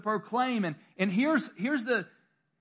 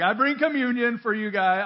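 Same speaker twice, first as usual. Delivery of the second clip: mock churchy offer; 4 semitones higher, 6 dB louder than usual. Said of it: "I don't go".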